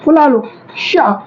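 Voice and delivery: शा said slowly, tongue pulled back and puffed; slow शा demo. Shown as a demonstration. Teacher shows students how to say शा, slowly. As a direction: stretch out शा slowly for the class. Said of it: "थोड़ा सा जीभ को पीछा करो".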